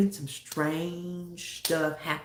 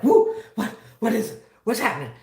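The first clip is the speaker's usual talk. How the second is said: startled; 3 semitones higher, 4 dB louder than usual. Retold or imitated. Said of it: "I'm like".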